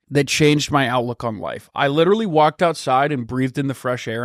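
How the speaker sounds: usual speaking voice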